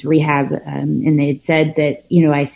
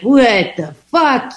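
higher, slow disbelieving scoff; mock scoff; 5 semitones higher, slower than usual. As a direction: sneer at their pink cloud. Retold scoff at others' sharing.

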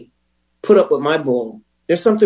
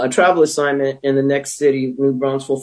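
normal talking voice

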